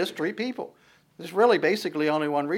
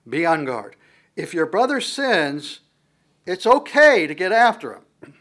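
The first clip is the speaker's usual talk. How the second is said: preached emphasis; 4 semitones higher, 9 dB louder, slower than usual. Acted. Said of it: "preacher stressing scripture".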